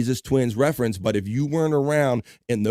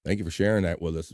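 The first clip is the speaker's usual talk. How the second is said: ordinary talk, nothing marked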